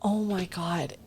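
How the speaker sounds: soft, hushed moment of revelation; gently awed whisper; soft awed realisation. Retold or imitated. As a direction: breathe it out in quiet wonder. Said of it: "And I was like".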